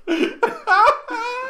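loud squealing laugh; high burst of laughter. Real, not acted. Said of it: "I'm laughing at my own joke".

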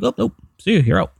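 high-pitched flippant send-off; mock cheery eviction brush-off in a high voice; mock dismissal. Mimics an arbitrary eviction dismissal.

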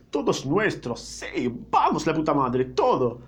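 rowdy, jubilant outburst; excited cheering. Acted. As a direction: celebrate like a kid claiming a prize. Boy jumps out, claiming the city. (festejo eufórico y a los gritos)

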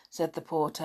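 plain reading voice

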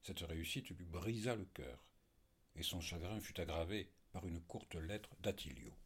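plain reading voice

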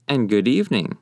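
ordinary delivery

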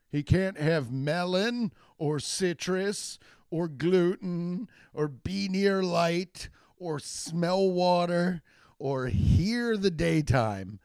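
slow, deliberate listing. Acted. Comic listing the baby's absurd allergies, slow and deliberate. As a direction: spell out each item slowly for effect.

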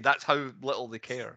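ordinary talk

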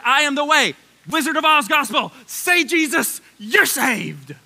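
loud, high parody of a salvation slogan; mocking gospel pitch. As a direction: belt it out like a mock revival pitch. Parodies the gospel people heard.

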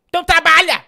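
loud, high, demanding yell at a silent cohost; mock shout. Streamer yells at muted cohost to get working, mock shout. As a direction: bark the order up high and loud.

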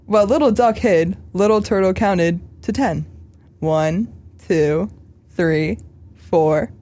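put-on earnest storybook voice for children; solemn read-aloud of a kids' tale; children's story reading. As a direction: read it like to an auditorium of kids.

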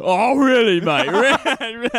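high, loud mock-British needling; squeaky-high British mimicry with a laugh; mocking British accent. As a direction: tease him in an exaggerated British accent. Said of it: "kept doing an accent of him".

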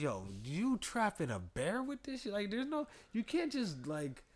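high incredulous rant. Retold or imitated. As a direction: pitch way up in disbelief, mocking the trap. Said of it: "I'm like".

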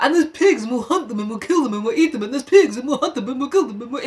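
relentless singsong run-on list; breathless repetitive babble, in mockery; mock obsessive rambling. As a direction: imitate someone droning on excitedly without a break. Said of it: "Jack just keeps talking about".

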